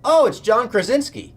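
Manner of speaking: blurting it out at full volume, high and loud. Retold or imitated. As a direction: yell the name out in a silent room. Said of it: "As loud as he could have said it".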